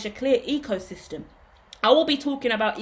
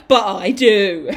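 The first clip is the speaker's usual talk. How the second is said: laughing; 9 dB louder, much slower than usual. Real, not acted. Laughing at own joke.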